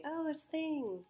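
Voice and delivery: high, slow "oh" of discovery; pupil's realization. Acted. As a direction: act a pupil who just caught it. Acts pupil discovering the answer.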